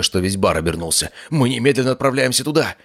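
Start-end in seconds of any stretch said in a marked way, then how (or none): 1.3-2.8 high, urgent call to go; rally everyone at once; excited. Acted. Excited shout after the news.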